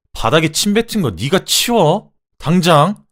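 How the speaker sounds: furious loud command, pitched high; angry shout. A narrator's reading. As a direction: yell the order at a spouse. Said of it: "소리를 질렀어요". (화가 나서 버럭 소리치며)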